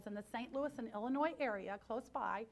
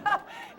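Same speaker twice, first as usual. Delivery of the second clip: a burst of laughter much higher and louder; 6 dB louder than usual. Real, not acted. Speaker laughs with the audience about the butterhorns, a high laugh. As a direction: let out a real laugh.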